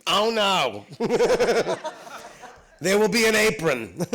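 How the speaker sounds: high, loud theatrical protest; Nathan Lane. Retold or imitated. Actor refusing to play the scene nearly naked, loud high protest. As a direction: put your foot down, loudly and high. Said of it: "Nathan, when he was doing the play, said".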